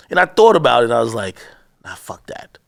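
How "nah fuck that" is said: soft unvoiced nah, fuck that; under the breath, dismissive; muttered to himself. Thinking it over alone, quietly muttered. Private thought refusing.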